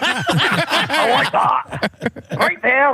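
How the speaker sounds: put-on high, slow Scottish accent, shouting; thick Scottish accent, building to a yell. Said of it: "with that thick Scottish accent".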